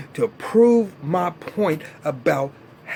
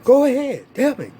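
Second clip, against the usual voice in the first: higher, cocky mock of Benny daring her; 8 semitones higher, 3 dB louder than usual. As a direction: play the smug boyfriend, pitched up. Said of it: "He gonna be like".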